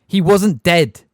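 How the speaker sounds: put-on boy protesting angrily to his teacher; angry. A narrator's reading. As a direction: snap it back at the teacher. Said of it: "said Harry angrily".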